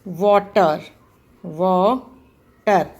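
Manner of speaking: वाटर with a hard retroflex ट, slow and drawn out; retroflex t in water. Shown as a demonstration. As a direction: say वाटर stretching the ट sound. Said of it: "जैसे कि मैं कहूँ".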